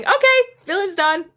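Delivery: mock-announce it as a job wrapped up; brisk triumph. Singer retelling how fast the song was finished, brisk triumph.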